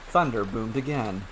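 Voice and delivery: plain reading voice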